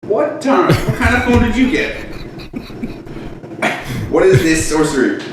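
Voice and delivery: high-pitched, hammed-up astonishment; mock amazement. Co-host amazed that the phone makes noises, mock astonishment. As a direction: marvel in exaggerated disbelief.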